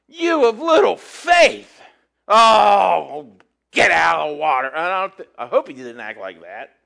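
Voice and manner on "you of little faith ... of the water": harsh condemning Jesus. Retold or imitated. Stern Jesus scolding sinking Peter, a mock harsh rebuke. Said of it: "I don't think he was like".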